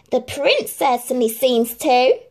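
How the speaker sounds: jealous sister sniping back; Anastasia, catty. Acted. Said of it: "replied Anastasia".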